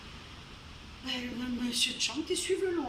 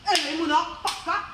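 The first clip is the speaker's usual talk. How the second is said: ordinary delivery, nothing marked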